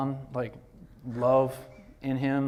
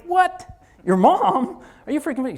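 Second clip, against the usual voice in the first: loud, high-pitched disbelieving outburst; incredulous shout; 10 dB louder than usual. Retold or imitated. Retelling his reaction to his assistant's surprising answer, incredulous shout.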